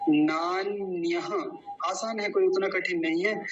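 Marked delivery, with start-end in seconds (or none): 0.1-1.5 नान्यः slowly as naan-nya-ha; voiced visarga. Shown as a demonstration. Teacher reminding students of the visarga, slow demo. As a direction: finish नान्यः on a breath of "ha". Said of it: "विसर्ग ना भूल जाएं".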